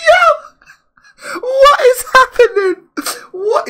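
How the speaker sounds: high, loud, half-laughing cry of shock; shocked disbelief. Real, not acted. Shocked friend watching.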